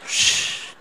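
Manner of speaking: long unvoiced shh of release; relaxed exhale hush. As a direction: let the air out, fully relaxed. Así me bajé.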